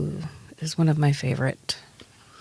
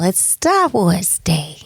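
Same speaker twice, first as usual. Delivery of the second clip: ordinary talk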